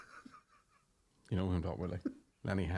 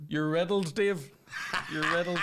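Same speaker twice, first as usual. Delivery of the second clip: mock Welsh; 7 semitones higher, slower than usual. Quoting Gavin Stacey lines.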